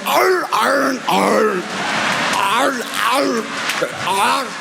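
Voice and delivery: high strained mock groans of a woman who fell; hammed-up high painful arr groans; imitated pained groans. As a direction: groan in pain like the grape lady. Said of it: "and then she went".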